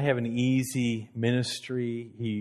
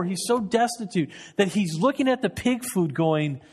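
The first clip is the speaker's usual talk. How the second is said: impassioned sermon build; preaching at full force; 7 semitones higher than usual. Acted. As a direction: preach it with rising fervor.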